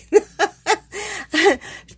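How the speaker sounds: high, loud burst of delighted laughter; real laugh. Real, not acted. Delighted by the stuffed box, laughing to viewers. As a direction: laugh out with glee.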